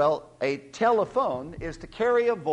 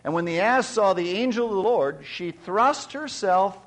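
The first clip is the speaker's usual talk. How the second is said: louder, raised solemn Bible reading; scripture reading; 5 semitones higher, 7 dB louder than usual. Acted. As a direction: proclaim the passage to the congregation. Reads scripture aloud.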